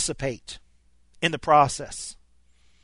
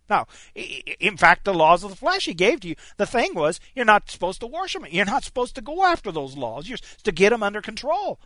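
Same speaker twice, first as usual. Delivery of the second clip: ordinary talk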